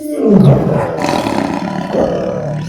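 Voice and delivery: loud growling roar, then a rough breathy growl; lion growl. Acted. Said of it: "said the lion".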